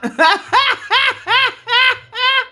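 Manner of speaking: loud high laughter. Real, not acted. Laughing to viewers about businesses run into the ground. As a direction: laugh at your own past.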